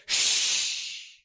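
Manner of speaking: whispered shush; hush. Retold or imitated. Jesus hushing wind and waves, whispered. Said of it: "Jesus actually said".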